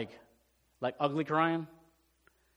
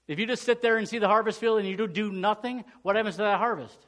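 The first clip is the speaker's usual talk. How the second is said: louder, higher preaching, pressing every phrase; full-force preaching; 6 semitones higher, 4 dB louder than usual. Acted. Pastor preaching to his church, full force and raised. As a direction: drive the point home to the congregation.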